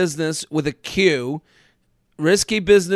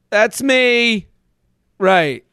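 ordinary talk, nothing marked

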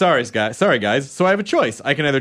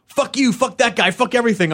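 mock angry commenter; 8 semitones higher than usual.

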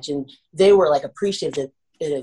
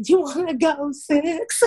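sheepish counteroffer; 10 semitones higher than usual. Retold or imitated. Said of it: "I'm like".

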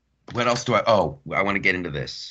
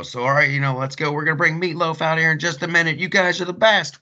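hyping the crowd; 9 semitones higher than usual. Retold or imitated. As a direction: pump up the crowd before the headliner.

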